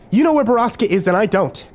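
high-pitched, upset realization; agitated accusation. A narrator's reading. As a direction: accuse them in high, rising alarm. Alarmed accusation in a car chase.

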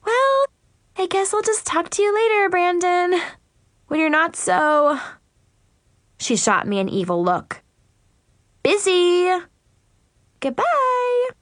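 high, sugary snippy goodbye; sweet on the surface, catty underneath; snippy mean girl. A narrator's reading. Mackenzie leaves in a huff.

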